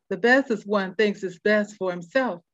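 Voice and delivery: normal talking voice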